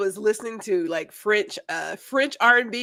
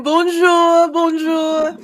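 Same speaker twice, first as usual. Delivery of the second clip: cheery bonjour; 7 semitones higher, 8 dB louder, much slower than usual. Real, not acted. Cheery French greeting on air.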